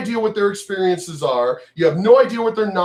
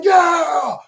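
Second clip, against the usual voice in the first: high, long cheering yell; mock crowd cheer; 10 semitones higher than usual. Streamer imitates everybody cheering the speech, high mock cheer. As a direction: cheer along like a brainless crowd. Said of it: "everybody's going".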